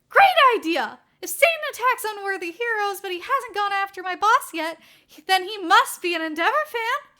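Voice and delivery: high, loud, eager burst; a sidekick thrilled by the plan; enthusiastic. Acted. Burnin cheering Naomasa's idea, loud and high.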